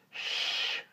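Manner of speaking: long voiceless shush; drawn-out "shh"; hushing shh. Acted. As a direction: hush a friend sharply. Boy hushes his friend.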